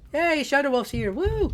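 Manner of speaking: high cheerful hey, woo; excited greeting. Real, not acted. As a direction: greet them with a happy whoop. Greeting arriving friend.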